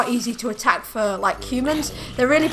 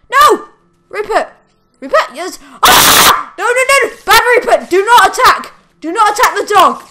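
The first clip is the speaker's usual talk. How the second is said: high, loud commands at the dog; frantic yelling; 9 semitones higher, 11 dB louder than usual. Real, not acted. Owner yelling at Rupert to stop attacking a dog, frantic shouting.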